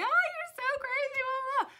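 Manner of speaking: squeaky mocking "oh you're so crazy"; mocking partygoers. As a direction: tease like a giddy crowd. Mocking partygoers voiced in retelling.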